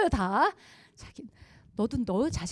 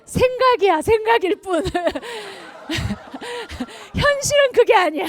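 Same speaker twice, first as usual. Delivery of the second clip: laughing; 11 semitones higher, 9 dB louder than usual. Real, not acted. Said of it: "laughing, far higher".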